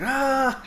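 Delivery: high-pitched "ah" yell at normal volume; mock loud cry. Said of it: "real loud like".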